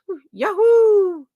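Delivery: high loud drawn-out cheer; celebrating a viewer's five hundred subscribers. Real, not acted. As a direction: whoop it up for a friend's milestone. Cheering viewer's 500 subs.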